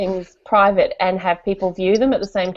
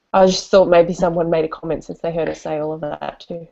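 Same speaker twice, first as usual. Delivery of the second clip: usual speaking voice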